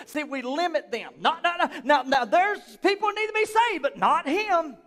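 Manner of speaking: ordinary talk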